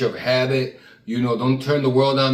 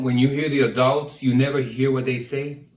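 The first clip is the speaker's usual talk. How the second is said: usual speaking voice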